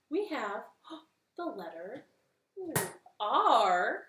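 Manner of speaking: gasping, then a loud R; surprised reveal. Acted. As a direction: build up the big reveal with gasps. Gasps revealing the letter.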